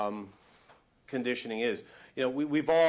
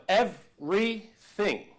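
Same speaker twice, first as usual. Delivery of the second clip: "everything" stretched slowly, much higher and louder; drawn-out emphasis; 7 semitones higher, 5 dB louder, much slower than usual. Real, not acted. Coach hammering his point home, "everything" drawn out loud. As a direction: lean long and hard on "everything". Emphatic repeat in pep talk.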